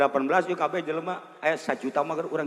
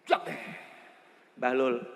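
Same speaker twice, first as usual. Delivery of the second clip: ordinary delivery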